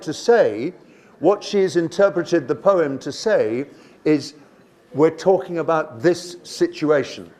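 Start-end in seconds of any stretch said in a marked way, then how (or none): none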